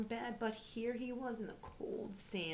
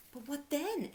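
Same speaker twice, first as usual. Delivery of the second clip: eager question; 8 semitones higher, 7 dB louder than usual. Acted. Teddy asking the owl.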